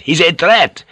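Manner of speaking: heated officer's tirade; storming. A narrator's reading. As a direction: argue furiously, pulling rank. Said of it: "Ferriera stormed".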